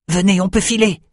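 rally them to get out; urgent call. A narrator's reading. Bannon tells his companions they can escape, urgently.

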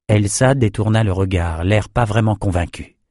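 ordinary delivery

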